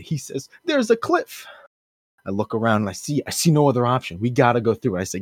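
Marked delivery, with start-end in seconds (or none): 0.6-1.5 high-pitched frightened squeak; mock panic. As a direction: play a terrified passenger, voice pitched way up. Panicked Gary warns of cliff.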